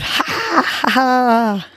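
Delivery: high forced ha ha ha; forced laughter. Shown as a demonstration. Host leading listeners in laughter breathing, forced laugh. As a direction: push out a fake laugh on the exhale. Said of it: "Go ahead and try it".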